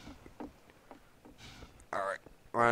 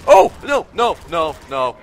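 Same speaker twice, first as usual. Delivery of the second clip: high, loud panicked wailing of no; real panic as death nears; screaming no no no; 8 semitones higher, 16 dB louder, slower than usual. Real, not acted. Panic in game.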